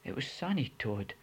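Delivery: ordinary delivery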